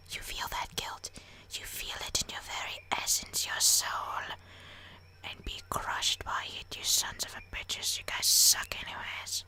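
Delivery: hammed-up menacing whisper; breathy, mock-sinister whisper; whispered curse. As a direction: hiss the curse in a theatrical whisper.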